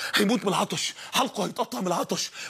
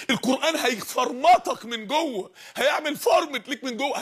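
ordinary talk

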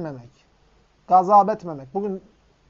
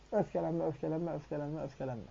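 each öfkelenme softer than the last, fading down to a hush; fading repetition; 3 semitones lower, 11 dB quieter than usual. Acted. Preacher echoing the Prophet's advice to a companion, fading softer each time. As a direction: let the repeated öfkelenme die away into near silence.

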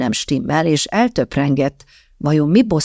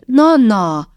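a sudden indignant cry, louder; outburst; 6 semitones higher, 5 dB louder than usual. A narrator's reading. Sóla bursts out at her aunt's insinuation, loud and indignant. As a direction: blurt the name out, offended. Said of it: "tört ki Sólából".

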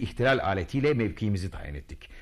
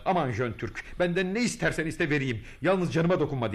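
mock pleading; 6 semitones higher than usual. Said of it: "diye yalvardı".

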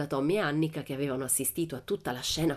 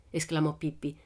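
ordinary delivery, nothing marked

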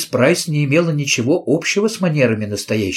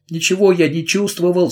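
tearful; 6 semitones higher than usual. Acted. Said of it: "сказал он плачущим голосом".

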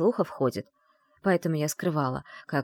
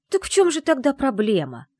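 high, loud, frustrated question; exasperated demand; 9 semitones higher, 6 dB louder than usual. A narrator's reading. Secretary waving the scroll at the lord, exasperated.

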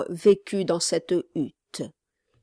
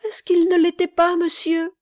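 much higher, fast, pleading; cling to hope, higher and faster; desperate mother; 11 semitones higher, 6 dB louder than usual. A narrator's reading. Mother answers gentleman's question, high and desperate.

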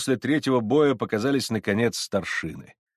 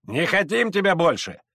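angry crowd shouting; 10 semitones higher, 4 dB louder than usual. Acted. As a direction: yell like a hostile mob. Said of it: "кричали из толпы казаки".

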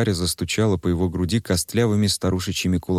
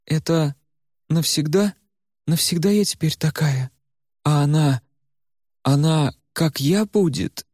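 put-on high, desperate girl's voice; Варя in despair; 8 semitones higher than usual. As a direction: ask in rising panic. Varya in despair. (высоким, отчаянным голосом Вари)

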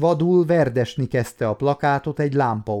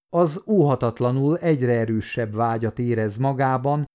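ordinary delivery, nothing marked

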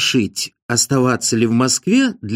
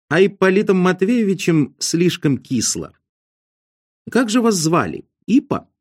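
higher-pitched, casually teasing Ostap figure; breezy great schemer; 6 semitones higher than usual. Acted. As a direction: voice a glib schemer pitched well above the narration.

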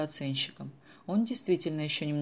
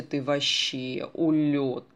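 slow, delighted, louder praise; 6 dB louder, much slower than usual. Acted. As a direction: savour the compliment with delight. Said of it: "с восторгом проговорила Даша". (восторженно, растягивая)

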